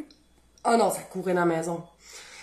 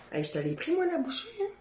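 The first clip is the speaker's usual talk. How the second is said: ordinary talk, nothing marked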